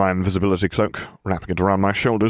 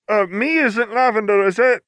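character voice. A narrator's reading. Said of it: "she said".

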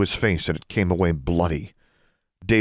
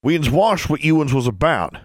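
high, raised accusing voice; loud accusation; 9 semitones higher, 4 dB louder than usual. A narrator's reading. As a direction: call out the accusation from afar. Accuser calling down to the group.

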